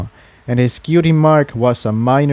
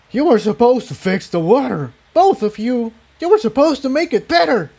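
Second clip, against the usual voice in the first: higher, accusing yell, speeding up; 11 semitones higher than usual. Acted. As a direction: shout the blame at them. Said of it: "he yelled".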